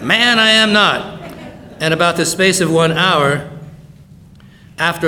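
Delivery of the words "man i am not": loud, high, drawn-out protest; emphatic denial. Acted. Preacher replays Peter's denial for laughs, loud indignant protest.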